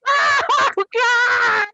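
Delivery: very high loud laughing cry; laughing exclaim. Real, not acted. Comedian cracking up at her own punchline. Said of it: "laughing at own punchline".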